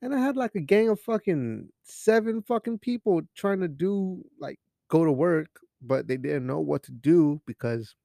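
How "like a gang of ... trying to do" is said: normal talking voice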